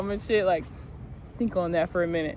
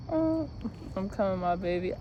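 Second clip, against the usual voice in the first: breathy mock moan; 6 dB quieter than usual. Imitating the song's moaning.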